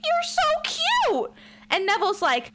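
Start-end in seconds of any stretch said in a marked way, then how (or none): 0.0-1.3 put-on high, gushing adult cooing at a child; gushing over a kid. Spencer gushing at the little boy, high and sweet. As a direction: coo it like he's adorable. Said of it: "And Spencer's like".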